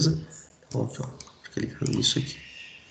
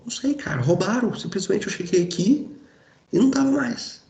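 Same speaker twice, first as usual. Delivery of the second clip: higher, hammed-up baffled victim; pitched-up mock bewilderment; mock clueless victim; 8 semitones higher than usual. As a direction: play the lost, helpless car owner. Acted theft victim answering who stole.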